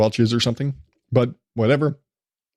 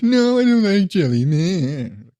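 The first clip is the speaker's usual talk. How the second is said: put-on 1 whiny kid refusal; 4 semitones higher, slower than usual. Older sons refuse jelly.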